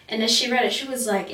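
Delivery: usual speaking voice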